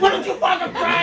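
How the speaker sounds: high-pitched mock outrage; hammed-up hothead picking a fight; imitated angry challenge. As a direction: confront him like an enraged stranger. Imitates same enraged man confronting him.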